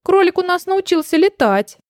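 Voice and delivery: mildly giggly teasing. A narrator's reading. Raccoon joking about the missing rabbit, lightly teasing. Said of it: "захихикал енот".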